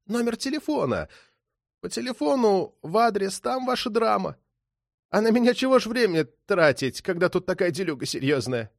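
high, thin voice, pleading; wheedling small-time crook; nervy petty thief. A narrator's reading. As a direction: reason with the cop in a squeezed high voice.

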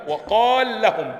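slow, loud drilled madd in وقال لهم; lengthened madd. Shown as a demonstration. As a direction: draw out the ا of وقال before the merged لام. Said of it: "أصبحت مدغمة".

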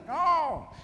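high, loud mock yell; mock shout from the crowd. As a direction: cry out like an eager volunteer. Man roept uit de menigte.

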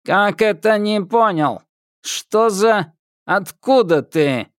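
metallic patrolman. Acted. Patrolman questions Thomas sharply.

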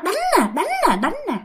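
mock beating guard. Underworld guard taunting the dead woman while beating her, mock. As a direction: chant it like a guard raining blows. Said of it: "mà người nhà mày khóc hả".

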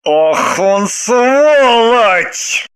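very slow, bitter, pressed; through gritted teeth. Acted. Husband curses the man, very slow through teeth. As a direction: grind it out. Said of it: "сквозь зубы процедил".

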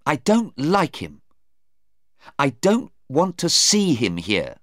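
mildly heated insistence; angry. A narrator's reading. Sarah rejecting Stephen's visit, angry. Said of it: "Sarah was angry".

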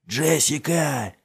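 slow, high, drawn-out call; stretched urgent shout; shouting a name. A narrator's reading. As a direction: holler so it carries on the wind. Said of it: "доставив его крик по назначению".

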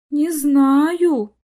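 wavering mother's reply; bewildered drawl. A narrator's reading. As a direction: drag the words out, at a loss. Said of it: "растерянно протянула мама".

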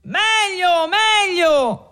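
very high, sustained cheer; approving cheer. A narrator's reading. Tavern crowd approving Renzo's retort, high cheer. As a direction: call it out like a delighted crowd.

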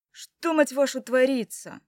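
loud, furious demand; angry outburst. Acted. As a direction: lash out at them. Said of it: "налетает на меня Бойд".

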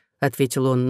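ordinary delivery

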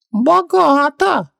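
very high, stretched-out squeak; slow, high djinn admiration; Хубаксис, impressed. Acted. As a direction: draw the word out in a tiny voice.

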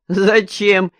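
scoffing and incredulous, half laughing. A narrator's reading. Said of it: "засмеялся". (насмешливо, со смехом, громче)

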